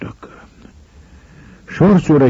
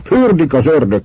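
high, indignant shout; shouting back; 9 semitones higher, 4 dB louder than usual. A narrator's reading. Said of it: "kiabált ki Schwarz úr". (felháborodott kiáltás)

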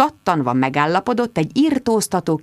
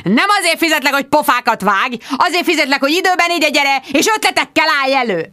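raised, loud scolding; angry boss shout; 7 semitones higher, 3 dB louder than usual. Acted. Boss scolding Bridget, loud and raised. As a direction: drive each point home. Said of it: "ordította".

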